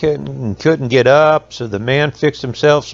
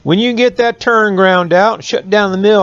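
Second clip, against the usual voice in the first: hollered over the mill; 7 semitones higher than usual. A narrator's reading. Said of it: "the man hollered back in Jack's ear".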